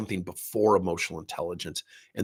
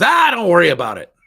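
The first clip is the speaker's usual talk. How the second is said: loud, breezy brush-off, much higher; dismissive brush-off; 7 semitones higher, 12 dB louder than usual. Retold or imitated. Leader brushing off a scared employee, loud and careless. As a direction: wave the worry away carelessly.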